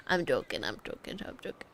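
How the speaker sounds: starts low and soft, fades to a breathless whisper; fading to a whisper. Real, not acted. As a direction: trail off into a whisper. Backing off a cheeky joke.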